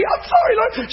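imitated tearful wail, very high; mock crying in a thin, high voice; bawling. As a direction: squeak out a sobbing apology as the wife. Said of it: "she's bawling".

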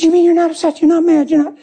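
high, rapid imitated questioning; disbelieving wife. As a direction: ask in high, tearful disbelief. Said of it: "She said".

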